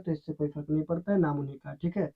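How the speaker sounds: usual speaking voice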